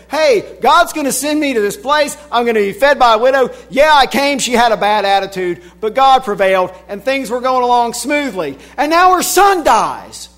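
high, loud, fast-rising retelling of Elijah's complaint; worked-up prophet voicing his grievance. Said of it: "here's a guy who said".

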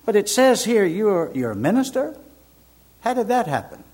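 retold incredulous query, lifting in pitch; imitating doctor's puzzled tone. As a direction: question it as if it doesn't add up. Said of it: "this is his tone".

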